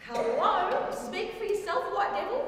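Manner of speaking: loud, high-pitched comeback; indignant retort. Acted. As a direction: call it out sharply across the room. Indignant retort to overheard remark.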